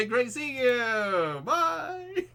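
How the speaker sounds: high, very slow drawn-out goodbye; awkward high exit. Acted. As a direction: back away awkwardly. Teen escaping awkwardly.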